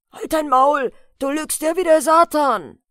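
farmhand flaring up, loud and high; angry shout. A narrator's reading. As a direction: shout him down furiously. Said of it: "rief jetzt der Großknecht". (wütend auffahrend, laut gerufen)

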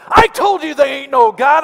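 high, loud taunt; gloating shout. Retold or imitated. Neighbor jumping out of the bushes at her, high loud taunt. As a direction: crow it out from the bushes. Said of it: "he said".

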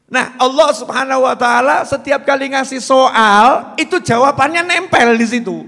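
loud, high sermon declaration; preaching at full force. Acted. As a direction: proclaim it to the congregation. (ceramah lantang)

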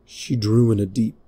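usual speaking voice

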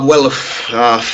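normal talking voice

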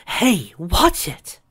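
high drawn-out yell. Acted. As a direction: call out sharply, slowly. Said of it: "Someone yelled to his right".

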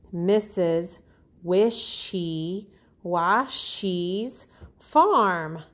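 drawn out, word by word; slow stretched title. Shown as a demonstration. Teacher slowly drawing out the book title for her students.

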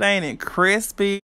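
ordinary talk, nothing marked

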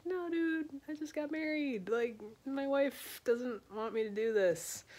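high put-on voice, whining no; comic high-voiced excuse; reluctant newlywed. Ross refusing, voiced in drunk retelling.

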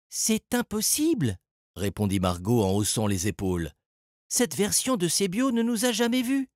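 high-pitched character voice; Margot, dismissive. A narrator's reading. Margot answers Salim's suggestion, high-pitched and dismissive.

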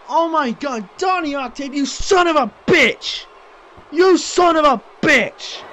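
loud angry shout, rising; furious cursing. Real, not acted. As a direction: yell the insult at him.

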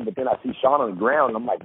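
usual speaking voice

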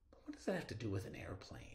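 hushed wondering aside, almost breathless; musing whisper. Acted. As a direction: whisper the question as if to yourself. Teacher muses aloud to class.

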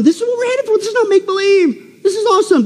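high, fervent exclamations; thrilled about the coming kingdom; excited. Real, not acted. Preacher thrilled, telling congregation it's real.

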